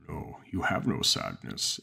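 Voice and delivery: slow, low monster voice with a heavy stress on have; a lightly voiced creature stating it calmly. A narrator's reading. Monster speaks to narrator.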